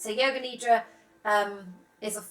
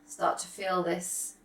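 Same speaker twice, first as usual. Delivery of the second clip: calm, unhurried meditation voice with long pauses; soft, settled yoga nidra guidance; guided meditation; 4 dB quieter than usual. Real, not acted.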